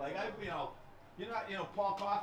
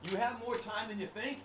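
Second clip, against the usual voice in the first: ordinary talk, nothing marked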